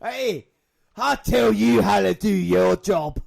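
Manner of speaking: loud, high hammed-up taunting; shouting wrestler. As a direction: bellow it like a wrestler at the ref. Acting out wrestler shouting in the match.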